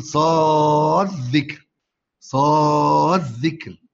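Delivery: صاد ذكر ببطء مع إدغام الدال في ذال مشددة; مدّ صاد ثم ذكر بذال مشددة بلا دال; إدغام الدال في الذال. Shown as a demonstration. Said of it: "يدغم الدال في الذال". (قراءة بطيئة لصاد ذكر بالإدغام)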